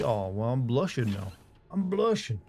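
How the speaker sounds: usual speaking voice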